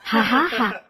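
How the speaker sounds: short scornful laugh; mocking laugh. Husband sneering at his wife, scornful laugh. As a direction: laugh at her contempt.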